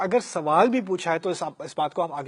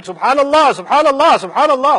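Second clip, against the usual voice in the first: much higher, louder outraged cry of سبحان اللہ; angry subhanallah; 9 semitones higher, 12 dB louder than usual. Retold or imitated. Companions protesting the name being struck, angry shout. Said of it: "غصے میں".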